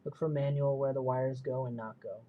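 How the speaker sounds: ordinary talk, nothing marked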